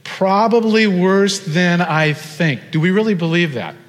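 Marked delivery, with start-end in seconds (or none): 0.0-2.7 slow, raised-pitch PROBABLY WORSE THAN I THINK; deliberate, emphatic call to repeat; leading group repetition. Shown as a demonstration. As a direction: lead the crowd word by word. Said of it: "Maybe say that with me".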